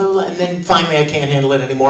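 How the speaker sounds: ordinary talk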